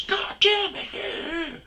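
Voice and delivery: drawn-out shrill mock swearing; angry woman. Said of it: "And she goes".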